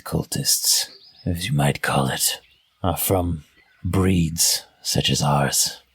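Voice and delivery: half grin, savour each word; half-orc sneering at a kindred spirit. Acted. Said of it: "she's just sneering".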